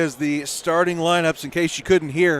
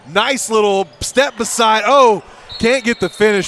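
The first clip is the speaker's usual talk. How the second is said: excited; 6 semitones higher, 7 dB louder than usual. Real, not acted. Near miss on a highlight play.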